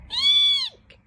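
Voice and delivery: piercing high shriek like a trodden cat; a tiny owl's first screech, very high and loud. Acted. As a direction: let out a shrill squealing eek. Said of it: "like the noise a cat makes".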